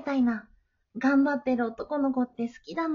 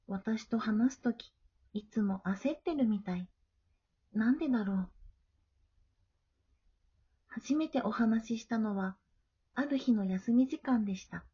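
story narration; 3 semitones lower than usual. A narrator's reading. Girl narrates her own story. (穏やかで静かな語り)

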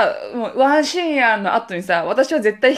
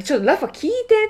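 excited call; 10 semitones higher, faster than usual. Retold or imitated. Excitedly calling friend over.

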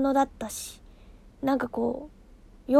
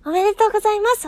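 higher, louder, fast "おめでとうございます"; cheerful congratulations; 6 semitones higher, 5 dB louder, faster than usual. Real, not acted. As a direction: burst out with the wish. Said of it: "ちょっとこんな声になっちゃうんですけど".

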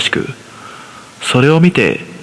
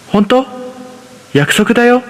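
put-on higher, eager child voice; higher-pitched excited young son; little boy voice; 11 semitones higher than usual. A narrator's reading. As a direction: voice a five-year-old begging his mother. Son asks for a promise.

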